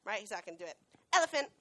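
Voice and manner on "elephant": "Elephant." high, bright and louder; "Elephant." pitched up like calling a puppy; higher pitched. Shown as a demonstration. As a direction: lift "Elephant." up high and loud to excite the dog. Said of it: "Make it higher pitched".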